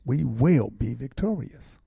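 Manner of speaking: slow, with will drawn out and leaned on; "We will be victorious" with weight on will; slow and emphatic on will; stress on will. Real, not acted. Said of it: "I'm always emphasizing that word will".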